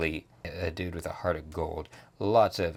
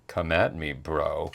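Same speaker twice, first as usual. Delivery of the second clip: low, lightly voiced dare; mild bro challenge; 5 semitones lower, 5 dB quieter than usual. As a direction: dare him in a slightly put-on low voice. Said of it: "he's like".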